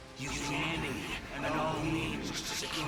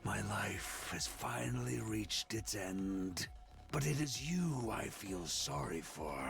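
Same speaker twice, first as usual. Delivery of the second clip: put-on weary, sad old warrior's last breath; dying words; 6 semitones lower, 6 dB quieter than usual. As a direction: sigh out your final regrets softly. Defeated boss dying words.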